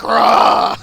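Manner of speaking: very loud rough roar; Gilligan's roar. Acted. Gilligan joining Mariah to scare panther, roar. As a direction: roar to frighten a beast. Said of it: "And Gilligan's like".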